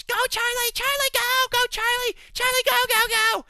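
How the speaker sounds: shrieking falsetto cheer, far above own voice; screaming wife. Retold or imitated. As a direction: imitate a wife screaming ringside encouragement. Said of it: "screaming at the top of her lungs".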